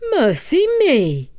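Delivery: put-on a startled old man's mercy; mild surprise. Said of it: "says Takitori".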